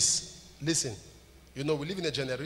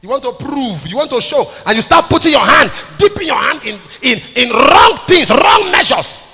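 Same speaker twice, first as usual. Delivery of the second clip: loud, high warning rising to full force; building preaching shout; 8 semitones higher, 21 dB louder than usual. Real, not acted. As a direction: drive each phrase harder into the crowd.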